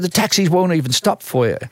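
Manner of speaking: a touch of alarmed street guy; stranger's warning. Retold or imitated. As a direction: warn a clueless visitor, lightly acted.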